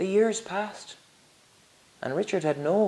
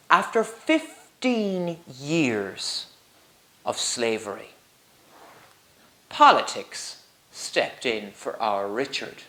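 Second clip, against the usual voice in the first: plain reading voice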